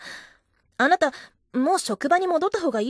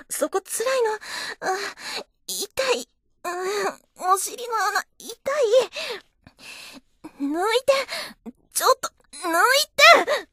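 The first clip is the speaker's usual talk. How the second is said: tearful pain; 8 semitones higher than usual. Acted. She whimpers in pain.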